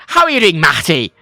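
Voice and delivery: loud, fully worded English greeting with "Matty"; English accent. Shown as a demonstration. Showing Matty in England, English accent. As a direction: imitate an off-putting English hello. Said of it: "Matty in England, it's like".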